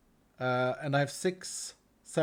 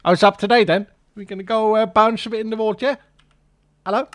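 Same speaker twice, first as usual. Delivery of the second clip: loud playful voice; 4 semitones higher, 10 dB louder than usual. Pretend. Voicing an item, playful.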